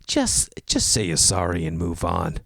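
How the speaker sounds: low, slow stranger's voice; mock stern advice. Paired-up stranger telling the golfer to apologise, mock stern.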